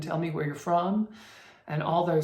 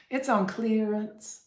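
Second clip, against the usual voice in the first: clearance in a slow Southern accent, a little higher, with a clear long ee; Southern drawl, lean on the ee in clearance; Southern accent, clearance with the E hit; 5 semitones higher, slower than usual. Shown as a demonstration. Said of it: "You really have to hit that E".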